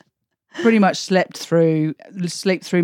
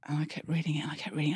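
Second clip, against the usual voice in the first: whisper; 14 dB quieter, much faster than usual. Retold or imitated. Rereading a text to herself.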